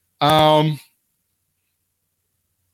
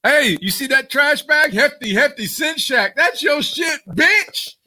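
mock yelling boss; 13 semitones higher, much slower than usual. Boss calling up a fired wrestler, mock yelling at her. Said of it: "Mark Carrano was calling bitches up and going".

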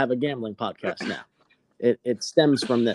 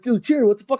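higher, mock-angry buddies demanding answers; a hint of outraged teen friends; friends confronting; 9 semitones higher, 5 dB louder, faster than usual. Friends confront him again.

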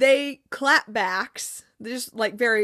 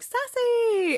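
high, drawn-out mock cheer of a name; excited greeting; 12 semitones higher than usual. Friends as Sassy shows up, excited greeting. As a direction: imitate friends cheering a new arrival.

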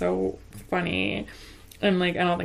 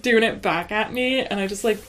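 ordinary delivery

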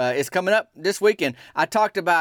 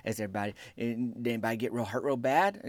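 hushed, concerned questioning; softer worried listener; 6 semitones lower, 10 dB quieter, faster than usual. Retold or imitated. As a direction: ask quietly, lightly worried. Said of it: "you saying".

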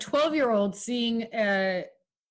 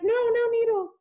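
high-pitched mock squeamish protest; squeamish plea; 12 semitones higher than usual. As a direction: play a queasy listener begging it to stop. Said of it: "they had to literally stop and be like".

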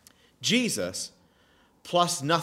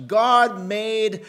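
"God made" raised high and loud; stress on GOD MADE; 6 semitones higher, 6 dB louder than usual. Real, not acted. Preacher showing the emphasis in the text, loud high stress on "God made". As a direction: ring out "God made", louder and higher. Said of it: "did you notice that emphasis in the text".

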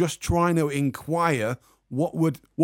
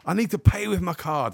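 exasperated; 7 semitones higher than usual. Retold or imitated. Arguing with clamper over cash.

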